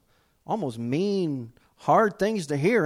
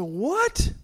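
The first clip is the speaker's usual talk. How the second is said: high, shocked disbelief; stunned, much higher what; 14 semitones higher than usual. Retold or imitated. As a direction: squeak it out in shock. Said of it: "I said".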